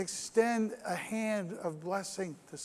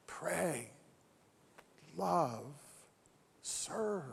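much softer, slow, pauses between words; hushed summary; 3 semitones lower, 8 dB quieter, much slower than usual. Acted. Preacher summing up three commands, hushed with pauses. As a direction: set each word down softly.

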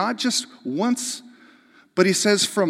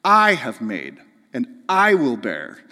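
I punched loud at the start of each phrase; emphatic I; 3 semitones lower, 5 dB louder than usual. Shown as a demonstration. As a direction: lean hard on every I. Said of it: "all of these I's in Hebrew after this are emphatic".

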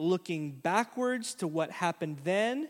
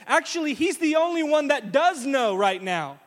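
much higher and louder near-shout; screaming narrator; 8 semitones higher, 7 dB louder than usual. Retold or imitated. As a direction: shout the irony at the crowd. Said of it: "Luke is screaming at us".

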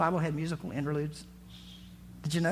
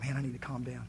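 much softer and lower, settling himself; calming down; 6 semitones lower, 10 dB quieter, faster than usual. Retold or imitated. David telling himself to calm down, much softer and lower. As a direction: drop right down, calming yourself.

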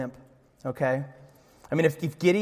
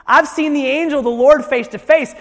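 loud, high-pitched cry of alarm; acted awestruck outcry; 8 semitones higher, 6 dB louder than usual. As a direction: cry it out in preacher's dramatized dread. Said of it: "he cried out".

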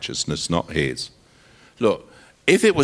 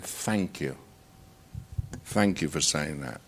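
softer, lower grateful wife; quiet thanks; 7 semitones lower, 8 dB quieter than usual. Retold or imitated. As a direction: lightly voice the wife's soft gratitude.

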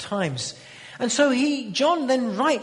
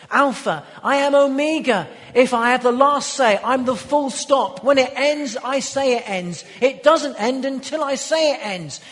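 loud, higher, declaiming God's authority; emphatic preaching as God; 5 semitones higher, 6 dB louder than usual. Acted. Preacher proclaiming God's words to the congregation, loud and higher. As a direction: proclaim each line like a final decree.

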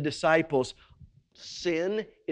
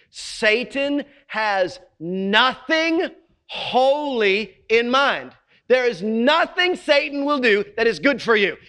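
full-force preaching; 10 semitones higher, 9 dB louder than usual. Acted. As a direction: preach it hard to the congregation. Preaching after "say that phrase again.

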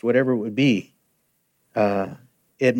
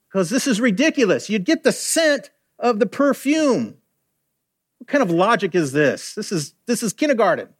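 mock scorn; 6 semitones higher than usual. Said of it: "guard thought it was ridiculous".

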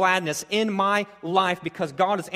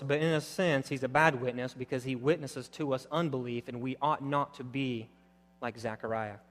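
ordinary talk

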